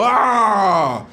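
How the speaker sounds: loud high mock groan of letdown; disappointed groan. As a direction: let out a big fake "ah" of disappointment. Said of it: "They go".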